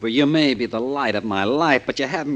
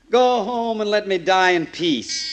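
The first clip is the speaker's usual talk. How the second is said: calling out; 9 semitones higher, slower than usual. Acted. Hank calling out to someone at door.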